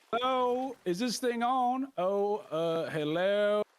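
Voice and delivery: high, drawn-out Southern host voice; booming announcer. Acted. Island host comes over the speakers, Southern announcer voice. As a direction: test the mic, then greet with a long holler. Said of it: "an echoey voice starts to announce itself".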